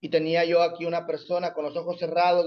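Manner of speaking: normal talking voice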